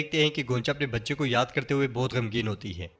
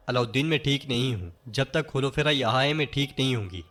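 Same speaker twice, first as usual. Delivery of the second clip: ordinary delivery, nothing marked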